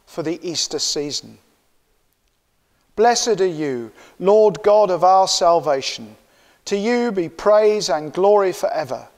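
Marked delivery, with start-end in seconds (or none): none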